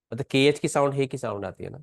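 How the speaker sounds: ordinary talk, nothing marked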